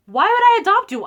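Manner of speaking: high, loud incredulous question; scoffing. Retold or imitated. Mom dismissing daughter's adoption fear, loudly scoffing. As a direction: toss it out as an absurd idea.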